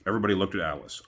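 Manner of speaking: usual speaking voice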